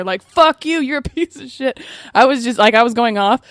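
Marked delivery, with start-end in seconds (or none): 0.2-1.8 retold scream. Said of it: "screaming at the bartender".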